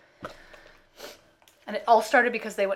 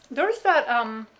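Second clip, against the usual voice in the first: talking through a mouthful of food; words muffled round a bite; mouth full; 3 semitones higher, 4 dB louder, much slower than usual. Real, not acted. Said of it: "I have a mouthful of food".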